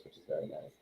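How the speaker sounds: ordinary talk, nothing marked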